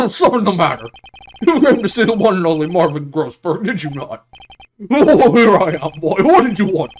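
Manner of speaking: booming, over-the-top bluster, high and loud at the start; pompous big-man lawyer. Acted. Lawyer greets the young man looking for him, booming pompously. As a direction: play a grandiose old attorney.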